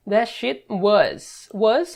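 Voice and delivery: very slow teacher read of "That shit was"; slow English model line; slow clear demo. Shown as a demonstration. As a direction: spell out "That shit was" word by word, slowly. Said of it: "lembra lá que é do verbo to be".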